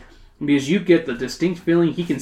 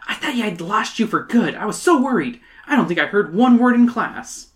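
higher voice full of relief; relieved; 6 semitones higher than usual. Acted. Emily to the fly she thought was lost, relieved. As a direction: let the worry out, pitched up. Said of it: "with relief".

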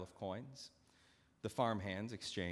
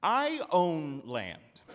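slow, louder, controlled fury; measured anger; 7 semitones higher, 11 dB louder, much slower than usual. Acted. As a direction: hold the rage in check, each word weighed. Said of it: "the master continued with measured anger".

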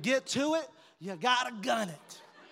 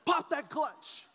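mock shout; 6 semitones higher, much slower than usual. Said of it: "We were out there just".

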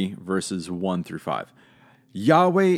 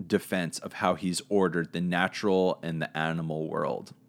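ordinary talk

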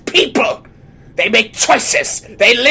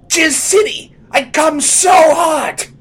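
slow hammy shout; 10 semitones higher, 6 dB louder, slower than usual. Acted. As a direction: belt it out, drawn out. Over-the-top outburst.

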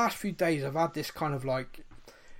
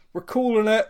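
loud, higher mock announcement; loud declaration; 7 semitones higher, 10 dB louder than usual. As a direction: declare it like a referee stopping a fight. Announcing they call it early.

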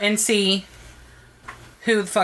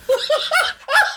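sudden high-pitched laugh; high burst of laughter. Real, not acted. Laughs at wrong name.

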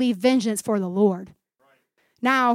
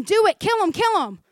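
urgent egging on; 8 semitones higher than usual. Acted. Voices friends urging David.

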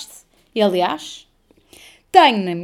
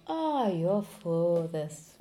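soft, slow sigh of dismay; imitated resigned swearing under the breath; inner thought; 2 semitones lower, 7 dB quieter, much slower than usual. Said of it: "É o que eu pensei". (desabafo baixinho e arrastado)

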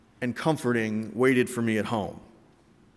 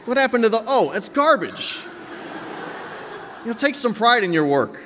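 mock outrage; 10 semitones higher than usual. Real, not acted.

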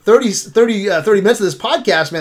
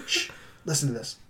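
much softer, laughing hush; 6 semitones lower, 18 dB quieter than usual. Retold or imitated. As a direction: hush him so you can listen. Hushing Ryan to hear a cricket.

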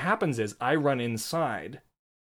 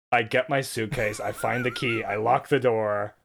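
ordinary talk

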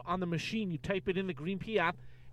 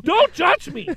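mock defensive; 13 semitones higher, 11 dB louder, slower than usual. Defending his BlackBerry, mock hurt.

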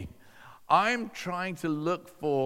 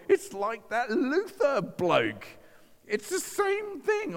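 high, drawn-out voice of discovery; astonished realization; 11 semitones higher, slower than usual. Retold or imitated. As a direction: exclaim it high, stretching the words.

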